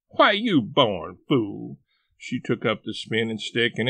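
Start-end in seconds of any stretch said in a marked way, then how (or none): 0.1-1.8 slow scornful scold. A narrator's reading. Aunt Sally scolding.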